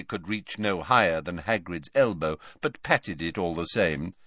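ordinary delivery

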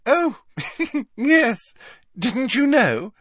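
put-on very high, loud girlish giggle through the words; giggly. A narrator's reading. Hermione revealing gossip to Parvati, with an uncharacteristic giggle. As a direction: giggle coyly as you confide. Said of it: "with a most un-Hermione-ish giggle".

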